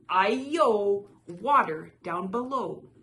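loud storybook warning cry. A narrator's reading. Runaway rice cake escapes the fisherman, crying out. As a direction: belt out the opening, then keep it loud. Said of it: "It cried".

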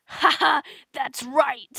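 loud, gloating laugh and boast; booming mock triumph; triumphant shout. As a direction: crow it loudly. Acted boastful character in skit.

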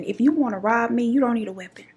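ordinary talk

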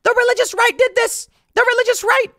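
high, loud shrieking accusation; mock hysterical blaming. Mocking the screaming woman blaming the religious right, shrieking. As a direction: screech the blame out of nowhere. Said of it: "out of nowhere, she goes".

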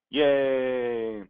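loud, high cheer; drawn-out celebratory yay. Acted. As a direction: cheer like the crowd at a happy ending. Happy ending cheer.

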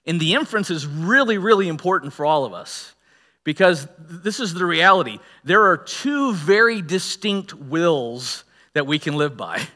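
ordinary delivery

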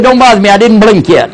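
high, loud, intent; a convert insisting he hasn't blinked; mock earnest friend. Friend answering at the airport, high insistent voice. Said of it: "He said".

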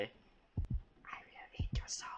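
slow, creepy whispered threat; demon whisper. Acted. Babysitter voicing the demon baby, slow whisper. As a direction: hiss it slowly like a demon in the dark.

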